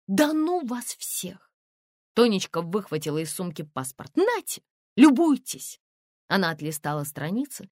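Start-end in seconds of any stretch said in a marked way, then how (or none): 0.1-1.5 высоко, медленно, с досадой; отмахнись от всех протяжно и высоко; раздражённое восклицание. A narrator's reading. Героиня в сердцах отмахивается. 4.1-5.8 высокий, громкий, протяжный выпад; громко и язвительно предъяви доказательство; с вызовом. A narrator's reading. С вызовом суёт паспорт.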